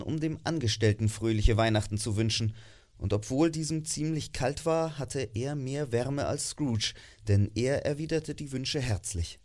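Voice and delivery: ordinary delivery, nothing marked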